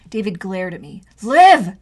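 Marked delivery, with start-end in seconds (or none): 1.2-1.8 loud, higher warning snarl of her name; brother's angry warning; growled. Acted. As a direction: growl it through clenched teeth. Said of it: "he growled through his teeth".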